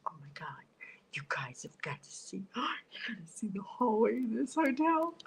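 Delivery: breathless unvoiced whisper. Real, not acted. Whispering to viewers while sneaking to the hotel hallway. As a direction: sneak the words out in a hush.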